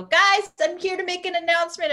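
high, loud mock announcement; announcing. [name] announcing to her brunch friends, high and loud. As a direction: call the table to attention, high.

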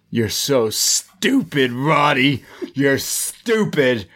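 slow, drawn-out scornful put-down; mock contempt. Recapper voicing Cole rejecting Roddy, slow mock contempt. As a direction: stretch each insult, coldly dismissive.

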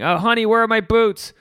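lightly acted call to a partner. Voices someone asking their partner.

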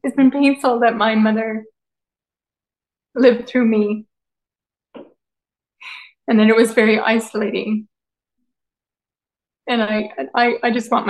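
fighting back tears while speaking; tearful. Real, not acted. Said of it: "I'm gonna cry".

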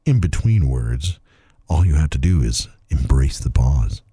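smarmy slow voice. Pretend. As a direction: drag out every pause. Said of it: "the smarmy voice was creepy".

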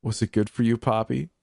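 high, sing-song cruel taunt; mock him sweetly. A narrator's reading. Taunting dying man.

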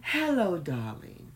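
high, gushing hello that drops; phony sweet voice. Retold or imitated. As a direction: coo it like a mother. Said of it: "That was her thing".

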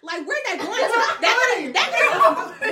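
high, loud laughing complaint about where the money goes; laughing rant. Real, not acted. To her friends about tuition, laughing high and loud. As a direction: cackle through the outrage, voice up high.

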